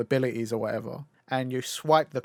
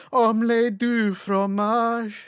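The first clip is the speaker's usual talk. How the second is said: higher, louder, slow mock French singing it out; hammed-up cartoon boy; 9 semitones higher, 5 dB louder, much slower than usual. Friend recalls Dexter in the booth, slow hammed-up French. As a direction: stretch it out like a kid star in a recording booth. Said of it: "in a booth going".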